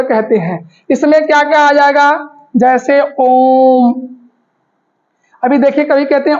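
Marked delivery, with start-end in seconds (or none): 3.2-3.9 ओम held long on one steady note; drawn-out Om. Shown as a demonstration. Teacher shows students a three-beat vowel, drawn-out Om. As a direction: stretch ओम out like a chant. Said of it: "तीन मात्रा का समय लगे".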